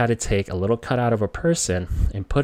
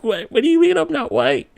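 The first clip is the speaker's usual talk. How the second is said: put-on very high, wailing kid voice; hammed-up tearful disbelief, pitch shooting way up; teary-eyed child; 4 dB louder than usual. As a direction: imitate a little kid protesting through tears. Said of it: "teary-eyed".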